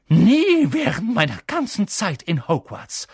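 high, loud, aghast teacher; appalled Professor McGonagall; horrified outrage. A narrator's reading. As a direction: gasp out the scolding in disbelief. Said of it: "fast sprachlos vor Entsetzen".

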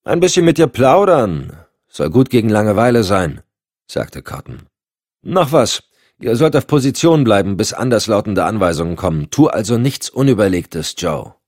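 dry, bossy superior; Cotton's voice. Acted. Cotton spricht über Funk. (Cotton, trocken und bestimmend)

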